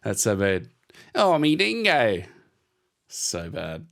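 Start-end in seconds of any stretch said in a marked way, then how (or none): 1.1-2.2 high, drawn-out mock Australian accent; bad Australian accent. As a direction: ham up a terrible Australian accent, slowly. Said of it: "worse than they do Australian".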